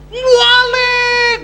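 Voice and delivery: very high, shrieked alarm; piercing yell. Retold or imitated. Preacher imitating someone who catches the thief, piercing yell. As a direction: cry out piercingly like a woman singer. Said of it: "teriakan kan melengking tuh kayak bidu wanita".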